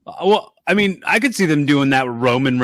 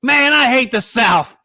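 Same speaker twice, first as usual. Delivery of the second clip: higher, slow drawn-out complaint; Cameron Grimes; 7 semitones higher, 3 dB louder, slower than usual. Retold or imitated. Retelling the wrestler's gripe, high slow imitation. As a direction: imitate a wrestler griping, stretched out. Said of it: "and then Grimes goes".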